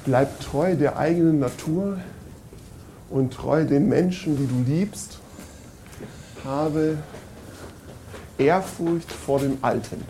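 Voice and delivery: slow, solemn inscription reading; solemn recitation. Acted. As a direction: read it out slowly and solemnly. (feierlich vorgelesen)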